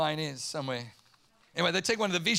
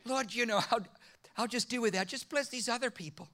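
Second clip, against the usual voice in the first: much higher, much softer, sheepish brush-off; meek deflection; 4 semitones higher, 5 dB quieter than usual. Retold or imitated. Pastor sheepishly declining before God, higher and softer. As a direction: shrink away from the question. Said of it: "I'm like".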